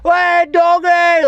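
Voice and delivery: high drawn-out shouted call; retold shout. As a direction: holler it from far away. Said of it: "screaming from here".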